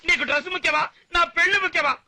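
high-pitched insistent appeal; agitated pleading. Acted. Groom begging his friend for the dress, agitated.

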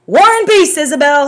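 high, loud teasing call. A narrator's reading. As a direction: call it back over your shoulder. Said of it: "he called out".

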